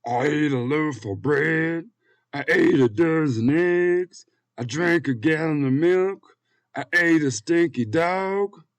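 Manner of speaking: loud, high, drawn-out fat man voice; fat man character. Acted. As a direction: boom it out slowly as the fat man.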